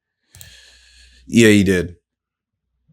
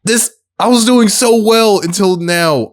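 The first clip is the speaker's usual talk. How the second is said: exasperated whine; 11 semitones higher than usual. Real, not acted. Frustrated at blanking.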